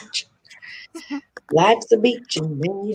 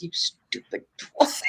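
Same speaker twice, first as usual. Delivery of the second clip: whispered insult; 3 semitones lower, 10 dB quieter, slower than usual. Retold or imitated. Retold insult to a friend.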